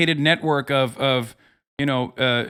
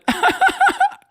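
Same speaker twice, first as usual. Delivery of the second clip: high cackle. Real, not acted. Laughing at own joke.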